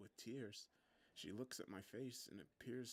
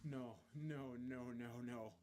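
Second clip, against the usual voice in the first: dawning horror; 2 semitones higher, slower than usual. A narrator's reading. Realizing girlfriend was in crash.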